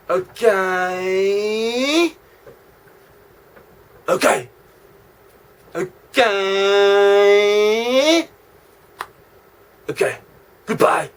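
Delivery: meek okays. Acted. Cowed reply to threat.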